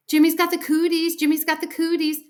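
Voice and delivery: high, loud playground chant; yelled taunt. A narrator's reading. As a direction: yell it like a teasing girl. Girl repeats her yelled taunt.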